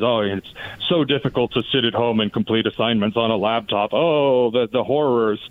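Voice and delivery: low, sarcastic mock lament; mock sympathy. Former teacher mocking excuses for unfinished online assignments, sarcastic mock horror.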